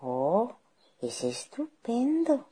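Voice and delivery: sly, slow delight, rising high; soft, scheming wolf; cunning wolf. Acted. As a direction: feign delight as a crafty wolf. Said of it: "dijo el astuto lobo".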